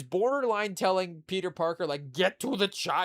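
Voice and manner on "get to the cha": Arnold impression. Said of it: "borderline Arnold".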